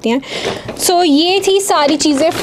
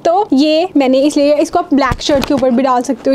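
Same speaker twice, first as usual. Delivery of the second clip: ordinary delivery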